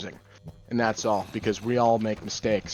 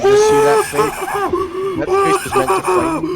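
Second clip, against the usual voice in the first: frightened shout; 11 dB louder than usual. Real, not acted. Startled by a scary game face.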